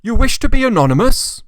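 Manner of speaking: hopefully assume a donation; hopeful gentleman. A narrator's reading.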